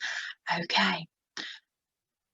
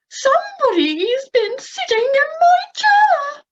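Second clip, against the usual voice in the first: much higher, louder squeaky mama bear; high Mummy Bear voice; 6 dB louder than usual. Shown as a demonstration. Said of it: "we'll use a quite high voice".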